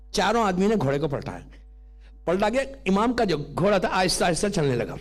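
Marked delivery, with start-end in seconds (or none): none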